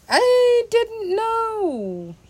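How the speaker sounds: drawn out. Real, not acted. Joking about bucket list.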